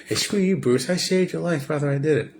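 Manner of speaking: high mock snap; pissed-off Robin. Robin telling Bruce off after saving him, mock bratty high voice. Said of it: "he gets pissed".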